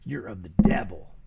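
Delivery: mock preachy zealot, dropping to a hiss at the end; mocking King James purist. Mocking strict King James believers, sinister whisper at end. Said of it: "it's like".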